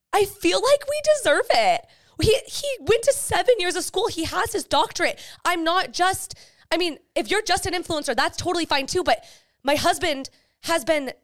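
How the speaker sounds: high, excited and eager. Real, not acted. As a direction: gush about it proudly. Excited defence of husband.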